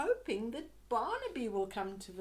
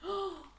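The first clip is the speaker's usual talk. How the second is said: mock gasp; 8 semitones higher than usual. Reader reacts to dragon picture.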